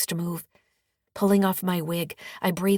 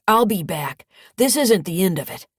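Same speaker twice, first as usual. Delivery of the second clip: angry threat; 3 semitones higher, 8 dB louder than usual. Acted. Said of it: "Dusty glares at me".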